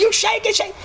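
short, very high imitated scream; mock screaming. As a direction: cry out insults in a mock screech. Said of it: "And she screams".

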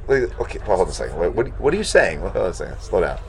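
low, soft interjection; calming him down. Acted. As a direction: talk him down under your breath. Calming the flustered rabbi.

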